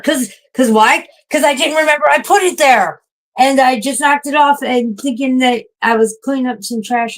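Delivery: high, loud, exasperated rant; frustrated rant. Real, not acted. Streamer explaining to chat why she knocked it off, angry rant. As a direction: vent it at yourself, fed up. Angry at herself after spill.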